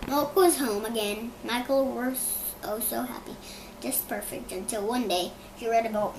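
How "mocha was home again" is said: usual speaking voice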